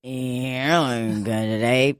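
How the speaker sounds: slow, lips barely parted; talking with mouth closed. Shown as a demonstration. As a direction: mumble it through still lips. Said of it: "you can say it without moving your mouth".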